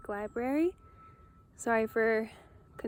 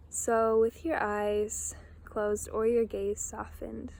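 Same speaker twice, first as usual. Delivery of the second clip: slow, spacious meditation prompt; unhurried guide voice with long pauses; guided meditation. Real, not acted. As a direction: ease them into imagining, pausing between phrases. Guides listeners to imagine.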